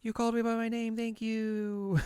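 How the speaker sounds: high, soft put-on squeal of thanks; touched character. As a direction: thank them in a tiny high voice. Acts Hanamaru touched by her name.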